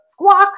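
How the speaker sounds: high, loud bird-call squawk. A narrator's reading. Classmate interrupting the know-it-all's answer, mock bird squawk. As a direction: screech it like a bird, cutting in.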